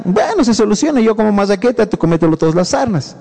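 usual speaking voice

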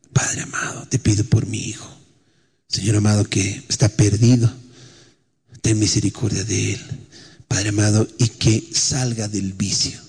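soft, low pleading prayer; a murmured mother's plea; hushed prayer. Retold or imitated. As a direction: pray it quietly under your breath.

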